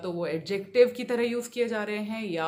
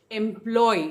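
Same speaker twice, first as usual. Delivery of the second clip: Employ as em-PLOY, end punched loud; employ with a heavy stressed last syllable; final-syllable stress; 6 dB louder than usual. Shown as a demonstration. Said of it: "third syllable पे stress है".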